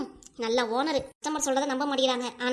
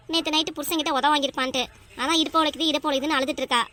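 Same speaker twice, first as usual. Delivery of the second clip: ordinary talk, nothing marked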